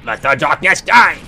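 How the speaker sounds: loud, high war cry; triumphant battle cry. Real, not acted. Gamer screaming as the final boss falls. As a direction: roar it as the killing blow lands.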